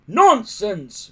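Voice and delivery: loud, high bellowed dismissal. Acted. Stoic brushing off his son with a loud bellow. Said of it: "bellowed Stoic".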